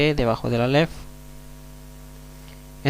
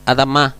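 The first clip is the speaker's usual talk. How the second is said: Adamá with a louder final syllable; stress on last syllable; 4 dB louder than usual. Shown as a demonstration. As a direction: model Adamá, stressing the end. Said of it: "Fíjense bien".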